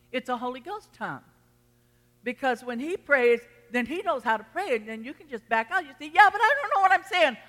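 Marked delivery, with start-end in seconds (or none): 6.1-7.4 high, fast, loud mock objection; acted protest. Preacher voicing a doubter of praying in tongues, mock protest. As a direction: protest in a raised, hurried voice.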